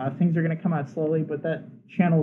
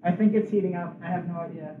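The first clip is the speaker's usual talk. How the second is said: usual speaking voice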